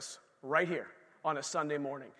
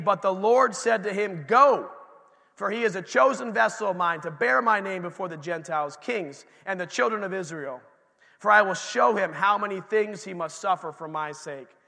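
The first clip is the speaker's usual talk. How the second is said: scripture reading; 5 dB louder than usual. Acted. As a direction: proclaim the Lord's words to the church. Preacher reads the Lord's words.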